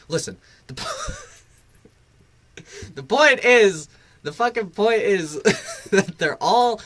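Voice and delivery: breaking into laughter mid-rant; words cracking with laughter; laughing rant. Real, not acted. Ranting through laughter.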